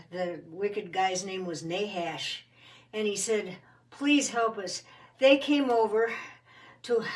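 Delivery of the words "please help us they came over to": breathless, louder plea that breaks off; winded. A narrator's reading. Winded messenger from Jabesh pleads with Saul, gasping. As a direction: run out of air mid-sentence. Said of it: "and he's winded".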